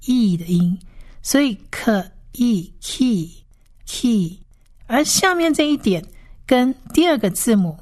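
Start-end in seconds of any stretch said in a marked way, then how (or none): 1.7-4.4 "可义，key" slowly blended from kh and ee; kh, ee, then khee for "可义，key"; sounding out. Shown as a demonstration. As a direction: split "可义", then join as a long "key". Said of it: "它是发义的音".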